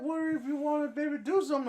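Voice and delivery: very high wheedling voice; sweet-talking high-pitched come-on; meth head voice. Retold or imitated. Retelling the stranger's offer to friends, in a very high wheedling voice. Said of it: "the voice sounds like a meth head".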